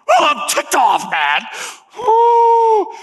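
high, loud furious shout; preacher playing Cain, yelling in mock rage; angry yell. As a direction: explode like a wronged brother.

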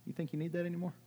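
voiced quote, high and offhand; casual brother-in-law. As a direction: imitate a brother-in-law's easy, high-pitched query. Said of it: "Jesus was more like my brother-in-law".

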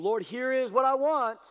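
loud, high, climbing plea; rising demand. Retold or imitated. Humanity side telling the Lord its wish, demanding. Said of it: "humanity side is rising up going".

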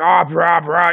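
lightly mocked rowdy sports commentator; jingoistic commentary noise. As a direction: imitate blustery commentary with mock noises. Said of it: "jingoism masala commentary".

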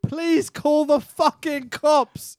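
high, slow, drawn-out exasperation; exasperated plea. Real, not acted. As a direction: plead loudly with the film, stretching every word. Exasperated plea at film plot.

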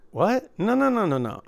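high, rapid-fire refusal; retold disbelief, pitch leaping up; incredulous objection. Dealer replaying his answer to a demanding player, incredulous.

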